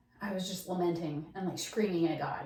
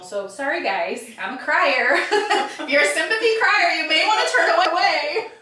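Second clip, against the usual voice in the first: higher and louder, laughing through tears; emotional laughing apology; 8 semitones higher, 10 dB louder than usual. Real, not acted. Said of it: "this story always gets me emotional".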